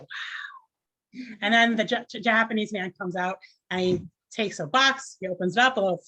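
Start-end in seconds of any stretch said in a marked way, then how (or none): 0.0-1.3 breathy hushed wow; awed crowd whisper. Retold or imitated. Onlookers marvel at the sliced fly, hushed. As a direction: gasp it out softly like an impressed crowd. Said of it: "everyone's like".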